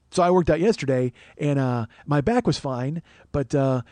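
ordinary delivery

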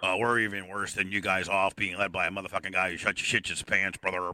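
low Canadian-hero persona; gimmick wrestler. Pretend. Caller lamenting his country's crisis, low gimmick wrestler voice. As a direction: pitch it low, like a wrestler's rant.

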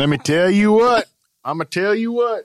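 high, loud hammed-up catchphrase. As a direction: belt out the catchphrase in a put-on high voice. Hamming up the catchphrase.

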